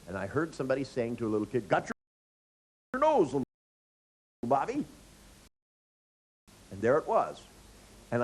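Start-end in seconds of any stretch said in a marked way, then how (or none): 1.7-4.8 high, louder playful singsong; adult playing got your nose with a kid; teasing a toddler. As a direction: tease a small child.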